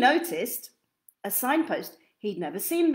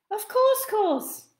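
much higher, cheerful little twin voice; little twin echoing everything brightly; smiling twin; 11 semitones higher, 4 dB louder, slower than usual. Acted. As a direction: beam and echo the last word. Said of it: "smiled Miss".

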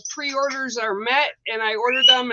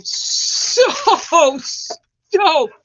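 giddy laugh; 5 semitones higher, 6 dB louder than usual. Real, not acted. Host tells viewers she is stoked, laughing. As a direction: laugh through the word stoked.

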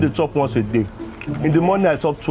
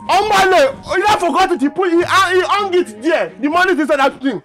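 high, raised-voice insistence; agitated explaining; 7 semitones higher, 3 dB louder than usual. Acted. Worker telling boss where the money is, agitated. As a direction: blurt it out high and urgently.

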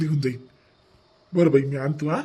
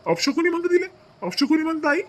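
much higher, shocked cry of disbelief; can't believe his mother said it; outraged disbelief; 11 semitones higher than usual. Acted. Son to his mother, shocked she calls the baby a bad omen, outraged high cry.

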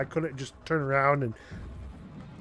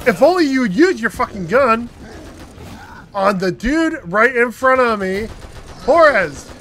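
loud, higher furious scolding; angry rant; 5 semitones higher, 7 dB louder than usual. Real, not acted. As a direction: chew out the useless partner. Scolding AI partner.

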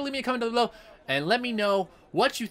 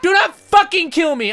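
loud, raised-pitch outburst; angry shout; 7 semitones higher, 9 dB louder than usual. Real, not acted. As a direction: yell a furious warning at the enemy. Yelling at the game boss.

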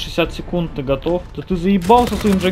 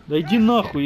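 ordinary delivery

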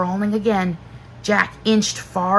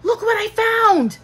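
high-pitched call; 12 semitones higher than usual. A narrator's reading. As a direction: call out a find to someone off away. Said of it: "Jack called".

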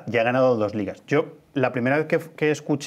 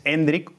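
"Hendrik" as slow EN-drik, first syllable stressed; 2 semitones higher than usual. Shown as a demonstration. Guest teaching the name, "Hendrik" stressed on the E. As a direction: lean on the E of "Hendrik", end on k. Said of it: "con acento en la E".

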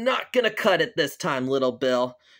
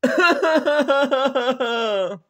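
high staccato bawling; fake crying; 6 semitones higher, 3 dB louder than usual. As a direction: wail in sobbing bursts like a scolded child. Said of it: "you need to quit crying right now".

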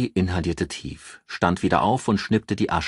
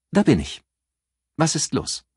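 higher, brisk female voice on the phone; a lightly raised voice for a sharp, self-assured friend; higher voiced Ann, matter-of-fact; Ann, brisk businesswoman; 10 semitones higher than usual. Ann's voice on the phone.

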